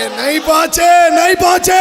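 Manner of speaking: preacher building the refrain to a loud call; rising shout. Real, not acted. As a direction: drive the refrain up to a loud cry.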